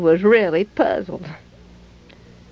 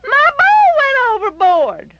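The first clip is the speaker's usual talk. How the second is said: put-on high wailing child's voice, rising at the end; a small boy wailing about his lost ball, pitch lifting; crying little boy; 4 dB louder than usual. A narrator's reading. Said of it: "he cried".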